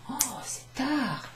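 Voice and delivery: high, slow mock dismay at the hour; a hammed-up gasp at the time; mock surprise. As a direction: sound shocked it's so late. Acting surprised it's late.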